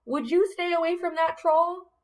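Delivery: usual speaking voice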